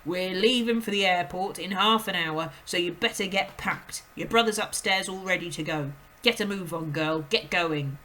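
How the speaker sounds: a father barking orders; gruff father. A narrator's reading. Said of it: "Mister Wormwood said".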